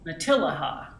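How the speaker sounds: Matilaha with a clear L; confirming correct pronunciation. Shown as a demonstration. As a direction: model "Matilaha" back, L sounded, "ha" at the end. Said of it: "pronounce it".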